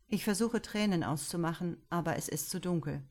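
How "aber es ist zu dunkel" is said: ordinary delivery, nothing marked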